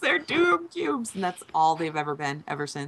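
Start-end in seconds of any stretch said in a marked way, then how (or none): 0.0-1.1 ominous. Pretend. Dramatic naming of doom cubes.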